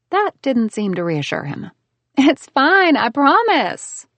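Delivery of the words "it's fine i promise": high, louder, slow I promise; bright insistent reassurance; eager to convince, stretch the promise; insisting. Acted. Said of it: "I insisted".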